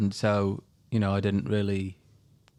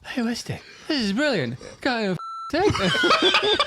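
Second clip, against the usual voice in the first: much higher, louder, laughing call; caddie shouting with ears plugged; 8 dB louder than usual. Retold or imitated. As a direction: yell like you can't hear yourself. Caddie Martin quoted, cotton in ears.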